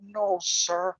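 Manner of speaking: hushed, timid reply; nervous. Acted. Oliver answering the Fat Controller, nervous. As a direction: answer softly, mock nervous. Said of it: "said Oliver nervously".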